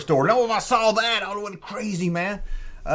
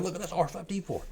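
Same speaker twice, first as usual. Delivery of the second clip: put-on nerdy kid; 14 dB quieter, faster than usual. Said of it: "I'd be like a nerd".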